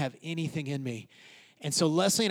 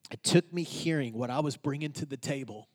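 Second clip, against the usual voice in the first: ordinary talk, nothing marked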